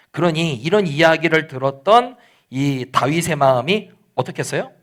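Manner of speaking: normal talking voice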